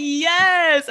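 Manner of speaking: high, loud, drawn-out confirmation; finally, they get it; exultant mock cheer; retold triumphant yes. Acts relief when friends get it.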